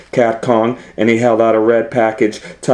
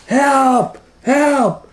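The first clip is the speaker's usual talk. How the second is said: very high squeaky cry for help; squeaked. Acted. Butcher squeaking for help as the cat attacks. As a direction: squeak it out like a tiny frightened mouse. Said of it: "squeaked the bu-, butcher".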